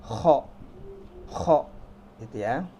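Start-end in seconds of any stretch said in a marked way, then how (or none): 0.0-1.6 "kho" scraped from the throat; emphatic rasped "kho" demo; throaty kho. Shown as a demonstration. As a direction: punch out a guttural "kho".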